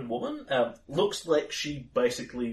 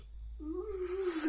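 nervous giggle; 9 dB quieter than usual. Acted. Klaus nervous laugh before sulking.

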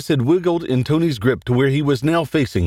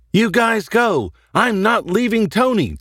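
high, urgent narrated yell; acted yell; 9 semitones higher than usual. A narrator's reading. Tracy sends friends off and stays for Tony, high acted yell. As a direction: pitch it up, urging the others away. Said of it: "Tracy yelled".

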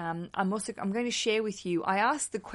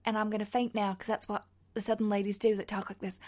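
put-on Southern belle accent; faster than usual. Podcaster joking about fainting, in a put-on southern accent. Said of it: "that is my southern accent".

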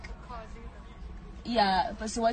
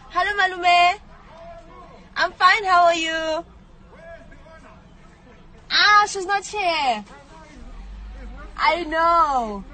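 polite phone voice; 6 semitones higher, 6 dB louder, slower than usual. Acted.

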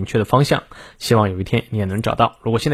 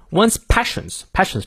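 "passions" stressed and drawn out slowly; 7 semitones higher, slower than usual. Shown as a demonstration. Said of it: "passions重读".

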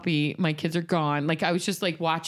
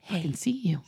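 spooky whispered threat; creepy whisper. Pretend. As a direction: breathe it like a stalker at the door. Imagined creepy line at door.

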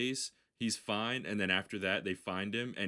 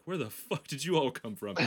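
startled outburst; 6 semitones higher, 4 dB louder than usual. Retold or imitated. Character startled by ninjas.

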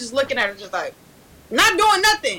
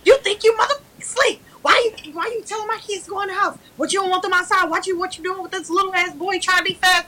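high-pitched retold scolding; mock angry mom; 6 semitones higher than usual. Mom confronting her child in the house, mock scolding. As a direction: fire off accusing questions as an imitated mother. Acting out mom's angry accusations.

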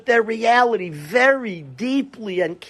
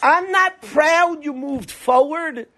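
higher, slow, syrupy mock pride; cheesy mock praise; 7 semitones higher, slower than usual. Mocking a hollow speaker to mourners, slow and higher. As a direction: drag out the so, mocking a cheap compliment. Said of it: "It's like the cheap thing to say".

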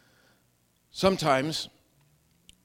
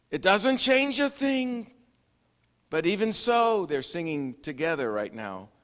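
ordinary delivery

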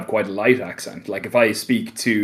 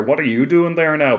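lightly imitated Northern Irish accent, pitched up; a higher-pitched quick taste of Northern Irish accent; 6 semitones higher than usual. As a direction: put on a mild Northern Irish lilt. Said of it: "the Northern Ireland accent".

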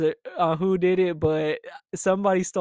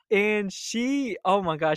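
ordinary talk

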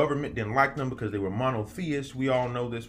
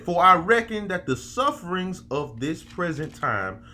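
preaching scripture; 3 semitones higher, 3 dB louder, slower than usual. Acted. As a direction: read it like a sermon from the pulpit. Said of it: "This man said".

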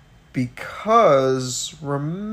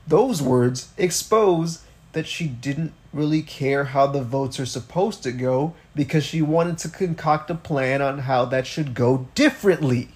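ordinary talk

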